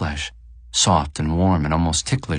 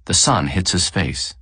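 ordinary delivery, nothing marked

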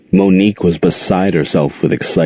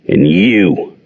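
growled. A narrator's reading. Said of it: "Jordan growled at Gerald".

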